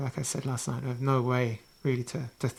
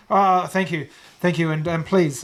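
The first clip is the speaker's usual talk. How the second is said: eager, louder thanks from the village leader; grateful Harmon; 4 semitones higher, 9 dB louder than usual. Acted. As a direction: gush your thanks, warmly.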